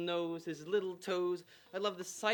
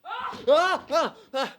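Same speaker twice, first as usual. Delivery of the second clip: loud, high yelps of pain as he tumbles; hammed-up falling-down-stairs screams; pained yells; 11 semitones higher, 14 dB louder, much slower than usual. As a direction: cry out mid-fall. Falls, breaks his collarbone.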